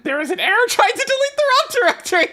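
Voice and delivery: fast, high-pitched rush; exasperated error readout. Real, not acted. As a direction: rattle it off high and incredulous. Exasperated at new error message.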